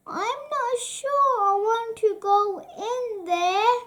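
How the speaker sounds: put-on a nervous little kid goat; small frightened goat; timid little goat. Timid young goat Beanie.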